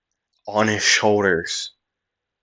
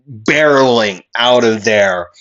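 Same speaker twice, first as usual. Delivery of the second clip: usual speaking voice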